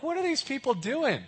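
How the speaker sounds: disbelieving scoff; baffled. Retold or imitated. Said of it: "You're like".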